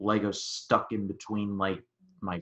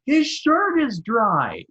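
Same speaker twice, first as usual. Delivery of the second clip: high, loud, drawn-out yell; yelled outburst; 12 semitones higher, 7 dB louder, slower than usual. Retold or imitated. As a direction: yell it to a whole theater like Roger Ebert. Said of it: "and I yelled out".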